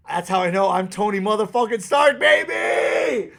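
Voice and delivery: loud, high hype shout; triumphant shout. Real, not acted. Proclaiming himself Tony Stark to his audience, triumphant shout. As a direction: yell it like a victory cry.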